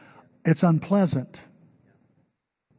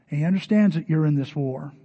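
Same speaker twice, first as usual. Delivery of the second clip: ordinary talk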